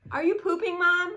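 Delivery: put-on high, loud, insistent child; loud toddler. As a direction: keep asking loudly like a nosy kid. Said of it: "[name] naturally has a loud voice".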